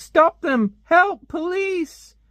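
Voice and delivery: high, loud hammed-up yelling; mock cry for help. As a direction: scream for the police like a cartoon lady. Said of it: "And she's like".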